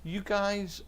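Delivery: high, mock-disbelieving voice; incredulous. Pastor relays Terry's text about cancelled service, mock incredulous. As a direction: retell the friend's text as if he can't believe it. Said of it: "and he said".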